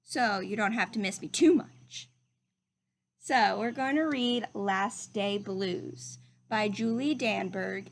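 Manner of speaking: ordinary delivery, nothing marked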